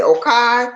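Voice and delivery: OKAY high and held, ending "kye"; attention-getting okay. Shown as a demonstration. Host explaining how he gets attention, high punched okay. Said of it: "that's the way I get your what? Attention".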